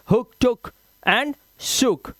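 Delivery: हुक, टुक, शुक spaced slowly, each vowel short; brief "u" in हुक, टुक and शुक; short vowel sound. Shown as a demonstration. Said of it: "ये sound है इसका". (हुक, टुक, शुक में छोटा उ)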